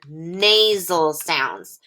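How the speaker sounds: "nasal sounds" drawn out slowly; slow teaching stress. Shown as a demonstration. Teacher slowly introducing a new term. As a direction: spell out "nasal sounds" at half speed. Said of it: "something called".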